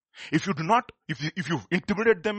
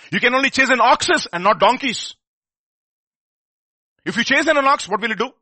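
preaching at full force; 8 semitones higher, 11 dB louder than usual. Acted.

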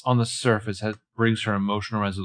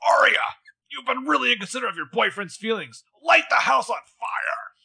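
put-on character voice; 11 semitones higher than usual. Pictunia urges Arya to act, in high put-on voice. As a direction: egg her on, high and hammed-up.

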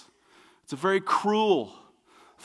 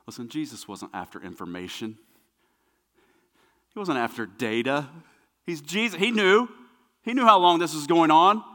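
ordinary talk

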